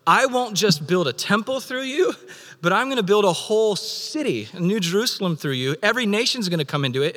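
high, fast and eager, building; God promising the world, thrilled; God vowing to build a city through his people, high and eager; excited promise. Acted. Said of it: "He's like".

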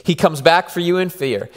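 ordinary delivery

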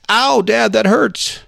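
high-pitched little son complaining of pain; hurt child. Acted. Son to his dad after kicking the wall, hurt child voice. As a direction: play a hurt kid whining up to dad. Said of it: "And he goes".

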